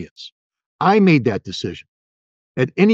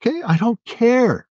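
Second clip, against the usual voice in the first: defiant dismissal; 11 semitones higher than usual. Real, not acted.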